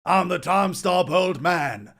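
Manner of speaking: put-on very loud, high, drawn-out old man; ugly bastard voice. Shown as a demonstration. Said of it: "my ugly bastard voice".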